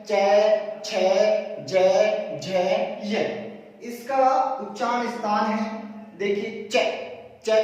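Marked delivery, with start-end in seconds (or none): 0.0-3.3 चै and जै punched out loud, one at a time; demonstrating sounds. Shown as a demonstration. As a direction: bark out each letter of the row. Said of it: "चै वर्ग में आता है".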